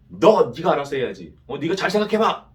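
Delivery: imagine God angrily telling Cain off; scolding God. Pretend. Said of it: "그렇게 가인을 혼내셨을까요". (가인을 혼내는 하나님, 크게 꾸짖는 목소리)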